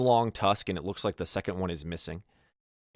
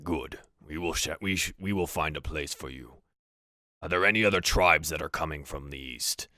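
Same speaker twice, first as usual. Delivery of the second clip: low chuckle into measured orc speech; slightly amused orc chief, voice dropped; orc leader; 3 semitones lower than usual. Acted. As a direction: orc leader addressing an underling, unhurried. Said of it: "Valrul chuckles a little bit".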